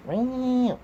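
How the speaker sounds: high-pitched imitated cat meows; sing-song mock meowing at a pet. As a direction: meow up high to call the cat. Calling to the cat.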